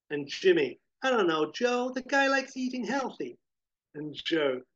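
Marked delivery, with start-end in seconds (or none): 1.0-3.4 flippant sidekick. Acted. Jimmy shrugs it off.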